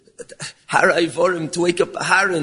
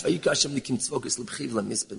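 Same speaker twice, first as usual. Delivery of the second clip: Vayikra Hashem Nikim Tzvokis Lebchi Ulemisped rattled off fast and much softer; quick soft repeat; 4 semitones lower, 9 dB quieter, faster than usual. Shown as a demonstration. Preacher re-reading the verse before explaining it, fast and soft. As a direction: run back through the verse quickly under the breath. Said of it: "What is the taich?".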